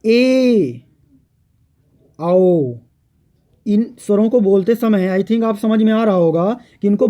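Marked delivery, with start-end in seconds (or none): 0.0-2.8 ए and आओ said out clearly; vowel demo. Shown as a demonstration. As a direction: give ए then आओ as examples. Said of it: "ठीक है".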